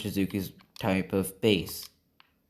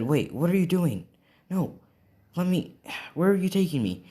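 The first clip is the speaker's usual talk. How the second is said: alarmed Kirishima; 8 semitones higher than usual. Acted.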